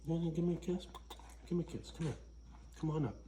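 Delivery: high, soft cooing to a pet; sweet dog talk. Real, not acted. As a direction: coax the puppy up for a kiss. Coaxing his dogs for kisses.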